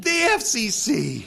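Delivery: slow, high, loud disbelief; shocked. Acted. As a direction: echo it back in shock. Shocked echo.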